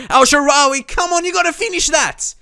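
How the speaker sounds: shouted high and loud; excited yell. Real, not acted. Gamer urging his winger to finish the chance, excited shout. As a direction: urge the striker to score. Chance on goal during match.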